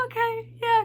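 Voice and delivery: high-pitched starstruck agreement; overwhelmed fan. Retold or imitated. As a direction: agree in a nervous squeak. Overwhelmed under idol's eye contact.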